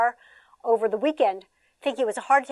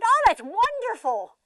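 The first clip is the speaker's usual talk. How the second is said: high, slow delighted praise; cheerful approval; 11 semitones higher, 4 dB louder, slower than usual. Retold or imitated. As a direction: sound pleased about it. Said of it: "And I said".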